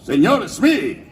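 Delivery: llamado teatral de capitán pirata, tono agudo; llama a tu subordinado con voz impostada; James Garfio. Shown as a demonstration. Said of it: "Que decía". (grito del capitán Garfio)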